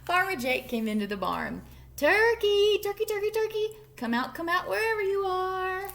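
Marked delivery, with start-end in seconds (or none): 2.0-5.9 high, loud sing-song call. A narrator's reading. Farmer calls hiding turkey.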